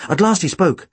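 ordinary delivery, nothing marked

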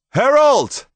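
high, full-voiced yell; angry shout. A narrator's reading. Angry Tomas to vanished herald, shouting up. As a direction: shout up the wall in rising anger. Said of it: "he shouted".

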